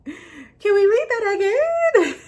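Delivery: high, loud laughing delight; real laughing. Real, not acted. Vlogger overjoyed rereading her article, laughing. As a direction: burst out laughing in joy.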